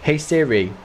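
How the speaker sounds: ordinary talk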